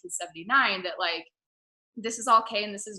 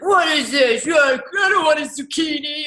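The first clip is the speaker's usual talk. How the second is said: loud, high mock tantrum; hammed-up shouted complaint, slower; mock angry husband; 6 semitones higher, 9 dB louder, slower than usual. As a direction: bark it like a grumpy husband throwing a fit. Husband throws a fit.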